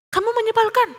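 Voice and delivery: high but softened repeat of a shout; echo. A narrator's reading. Valley echo answers Theo's yell, echoing. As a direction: send it back like an echo.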